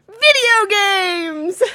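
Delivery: slow, high, loud announcement; drawn-out shout. Acted. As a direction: belt it out slowly like a cheer.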